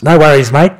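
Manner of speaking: loud, high-pitched Australian patient; joke punchline. Acted. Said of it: "And the guy responds".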